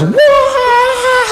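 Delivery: high, drawn-out imitated engine whine; imitated truck roar. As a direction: mimic the truck speeding in.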